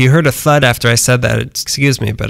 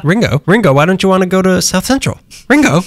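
higher-pitched mock calling out; 6 semitones higher than usual. Host calls after a runaway Beatle, mock calling out. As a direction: call after a runaway, playfully.

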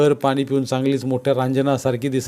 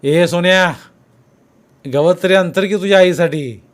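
louder scolding yell; angry shout; 5 semitones higher, 7 dB louder than usual. A narrator's reading. Officer yelling at Sonya to spread grass, angry. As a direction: snap at the boy to get moving. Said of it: "फौजदार त्याच्यावर ओरडलाच".

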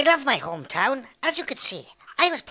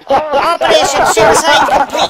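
very loud, higher mission announcement; triumphant shout; 4 semitones higher, 11 dB louder than usual. Pretend. Player announcing the silly mission is complete, triumphant shout. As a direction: yell it out through laughter.

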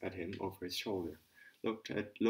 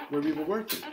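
higher, louder workman's reply; 8 semitones higher, 9 dB louder than usual. A narrator's reading. Workman answering.

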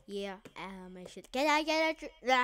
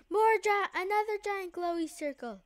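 excited; 9 semitones higher, 6 dB louder, faster than usual. Real, not acted. Eating a big circle.

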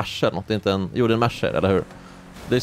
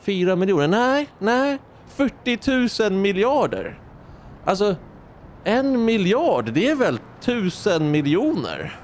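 mimicking her; 7 semitones higher than usual. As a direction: act out the confused calculation in a much higher voice. (hånfull imitation av politikern, ljus och långsam)

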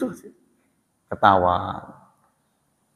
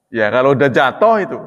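ordinary delivery

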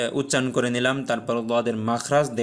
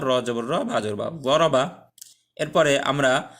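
ordinary delivery

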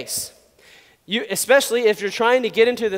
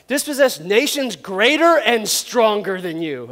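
slow, drawn-out mock proclamation; failing motivational speech; slower than usual. Moses undermining his own pep talk, slow and grand. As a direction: stretch the big words portentously. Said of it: "here's where he falls apart".

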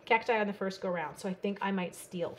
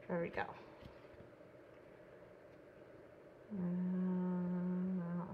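concentrating, tongue sticking out; 2 semitones lower, 12 dB quieter, much slower than usual. Real, not acted. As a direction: talk with your tongue out. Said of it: "sticking my tongue out".

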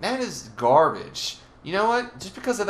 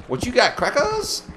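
taunting call; 11 semitones higher, 3 dB louder than usual. Pretend. Taunting hidden mobs, playful threat.

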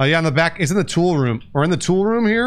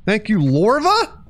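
higher-pitched announcer read-out of gifts; streamer shout-out; 6 semitones higher, slower than usual. Real, not acted. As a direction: announce the names with a lifted voice. Streamer thanks subscribers by name.

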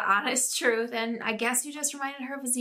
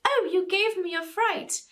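Parent Trap mom; 10 semitones higher than usual. Retold or imitated. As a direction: play up the startled mother, pitched high. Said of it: "She's like".

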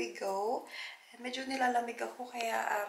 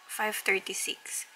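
usual speaking voice

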